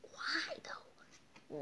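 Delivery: breathy secret whisper; whispered question. Real, not acted. Quizzing the book under her breath, a whispered why. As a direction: drop to a hush and wonder.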